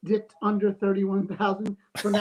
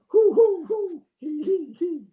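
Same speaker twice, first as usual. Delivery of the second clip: high, loud hoo hoo into high hee hee; strained panting hoots; 8 semitones higher, 4 dB louder, slower than usual. Retold or imitated. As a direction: mimic the man straining, Lamaze-style breathing. Imitating strained bathroom noises.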